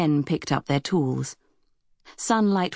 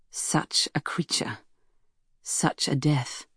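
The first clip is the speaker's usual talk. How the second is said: slow near-whisper; hushed, slow awe at a dead creature; hushed reflection; 4 dB quieter, slower than usual. A narrator's reading. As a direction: murmur it slowly, as a private thought. Narrated awed thought over dead whale.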